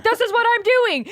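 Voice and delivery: loud, high mock insistence; Garrett. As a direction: imitate an amped-up husband digging in. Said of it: "that's exactly what it sounds like".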